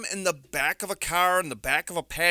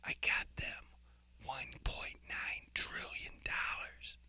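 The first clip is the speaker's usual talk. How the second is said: Joe Biden; 6 semitones lower, 13 dB quieter than usual. Retold or imitated. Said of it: "a little Joe Biden impersonation".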